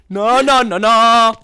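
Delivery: high, loud repeated cries; loud protest. Real, not acted. Gamer protests what happens on screen, loud cries.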